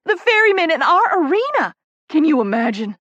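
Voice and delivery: high, loud fangirl squeal; squealing with excitement. A narrator's reading. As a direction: burst out in thrilled disbelief. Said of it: "She all but squeals with excitement".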